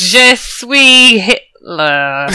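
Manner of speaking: slow, lightly voiced French; mock French accent. Player tries a German voice but drifts slowly into French. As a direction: draw it out with a hint of French. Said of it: "That is the wrong accent".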